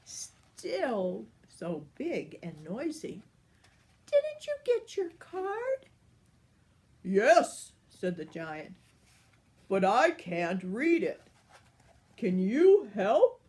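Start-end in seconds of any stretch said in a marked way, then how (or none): 4.1-5.9 much higher, tiny fairy voice; naughty fairy. Acted. The fairy asks the giant, tiny high voice. 7.0-13.4 louder giant pleading; booming giant. Acted. The giant asks the fairy for help, loud and booming.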